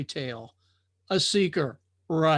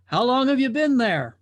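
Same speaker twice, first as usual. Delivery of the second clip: curious drifter; 7 semitones higher, 6 dB louder than usual. Acted. Drifter questions the watcher.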